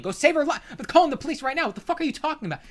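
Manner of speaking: high, frantic pleading; mock-panicked bystander, pitched right up; acted urgent alarm. Acts out an urgent argument over rescue.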